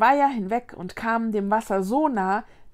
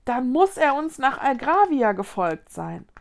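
higher, rising alarm; dismay building to a raised, horrified exclamation; horrified cry; 5 semitones higher, 5 dB louder than usual. A narrator's reading. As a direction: call it out aghast. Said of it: "rief Mila entsetzt".

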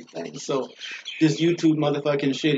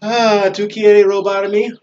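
loud, slow, higher Spanish taunt; mock Spanish taunt; 6 semitones higher, 8 dB louder, much slower than usual. Taunting the would-be robbers in Spanish, loud and slow.

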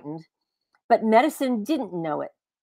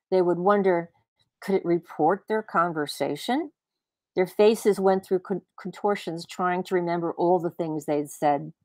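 ordinary delivery, nothing marked

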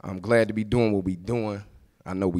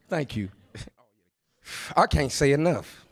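usual speaking voice

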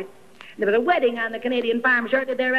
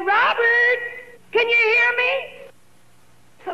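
pleading call; 8 semitones higher, much slower than usual. Retold or imitated. Widow kneeling at grave calling her husband, high anguished plea. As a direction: call out his name as if he might answer.

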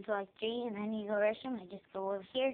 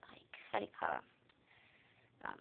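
whisper to self; 12 dB quieter, slower than usual. Real, not acted. Wondering aloud to herself.

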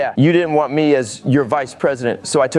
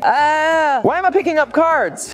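high-pitched bewildered protest; outraged disbelief; 10 semitones higher than usual. Acted. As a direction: demand an explanation in a high yelp. Outraged at picking up cards.

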